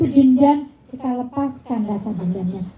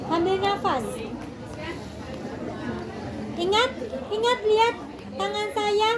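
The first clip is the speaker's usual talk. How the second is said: higher, soft, gentle guiding voice; calm guided breathing; 6 semitones higher, 7 dB quieter than usual. Shown as a demonstration. Guides butterfly hug breathing. (suara lembut memandu pernapasan)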